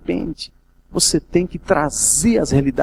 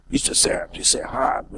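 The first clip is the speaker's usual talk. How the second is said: rigid legalist; 3 semitones lower, 6 dB quieter than usual. Retold or imitated. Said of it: "Não tô falando de legalismo".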